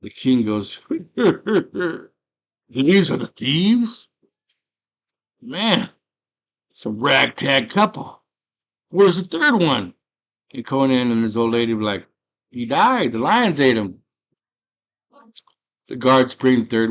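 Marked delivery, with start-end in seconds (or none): 0.8-2.0 high, loud put-on chuckle; hammed-up royal cackle; mock kingly laugh. The king laughs, acted. 2.7-9.9 loud, high booming royal voice; hammed-up monarch, raised voice; mock king. King addresses captured thieves, acted. 12.5-14.0 loud, raised-voice excuse; hammed-up answer to a king; mock protesting captives.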